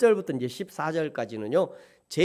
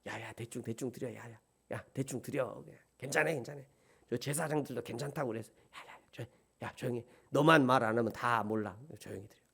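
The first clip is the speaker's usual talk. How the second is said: hushed mock priests, much softer; secretive low whisper, much softer; conspiratorial whisper; 6 semitones lower, 8 dB quieter than usual. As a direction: whisper like sneaky priests cutting corners.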